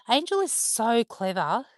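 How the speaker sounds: ordinary talk, nothing marked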